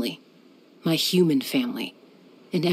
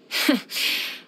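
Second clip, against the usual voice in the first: light laugh; 8 semitones higher than usual. Acted. Laughs after joke.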